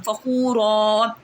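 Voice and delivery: फ़ख़ूरा said slowly, ending on a long stretched a; stopping on फ़ख़ूरा with the alif drawn out; pausal form. Shown as a demonstration. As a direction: stretch the final अलिफ as you stop. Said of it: "अब मैं वक़फ़ कर रही हूँ".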